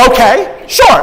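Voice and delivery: loud, high, startled okay; baffled okay. Retold or imitated. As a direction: caught off guard, go along. Reacting to Al's yell.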